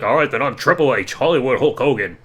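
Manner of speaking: half-hearted wrestler impression; Hulk Hogan voice. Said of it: "Hogan's like".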